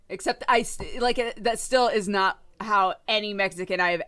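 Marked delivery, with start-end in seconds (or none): none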